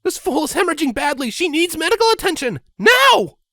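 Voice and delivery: high, loud, hurried commanding nurse; urgent nurse. Acted. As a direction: order staff to act now. Nurse demands urgent care.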